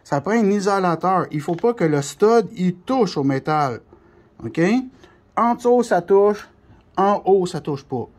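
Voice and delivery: ordinary talk, nothing marked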